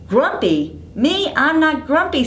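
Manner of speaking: squeaky on me, then denying; a grumpy monkey insisting he's fine; high indignant denial; indignant Jim. Acted. Jim protests again.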